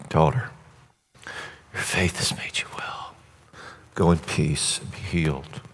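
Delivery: soft, hushed and tender, sinking to a whisper. Retold or imitated. Jesus tenderly blessing the healed woman, hushed. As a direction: speak it like blessing a beloved child. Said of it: "tender term, almost like baby girl".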